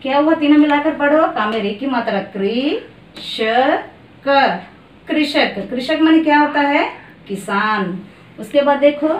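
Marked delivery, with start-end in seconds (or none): none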